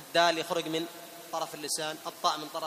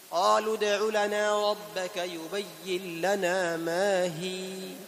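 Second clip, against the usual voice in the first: slow chanted verse; Quran recitation; much slower than usual. Shown as a demonstration. Teacher recites the next example verse. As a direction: recite it steadily and slowly. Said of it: "teacher recites verse".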